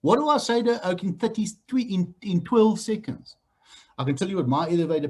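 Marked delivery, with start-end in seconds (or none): none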